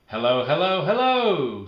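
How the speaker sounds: high, loud sing-song hello; excited greeting. Acted. Norma Jean calling to Amy, high and loud.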